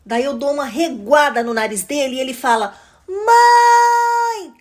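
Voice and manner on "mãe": put-on high, loud whiny child's yell; piercing tattletale kid calling out; irritating little voice. A narrator's reading. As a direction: yell for mom in an annoying little voice. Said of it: "com aquela vozinha irritante".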